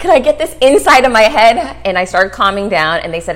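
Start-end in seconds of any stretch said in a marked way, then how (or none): 0.0-1.8 1 eager. Retold or imitated. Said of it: "I'm like".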